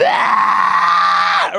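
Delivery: long, low strained "aah"; mock groan forced out and held; involuntary strained cry. Man with taint pushed in, long strained cry. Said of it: "there is a sound that comes out of you".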